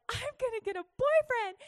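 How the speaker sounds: high, giddy mock declaration; hammed-up girlish excitement; boy-crazy teen. Imitating her boy-crazy younger self.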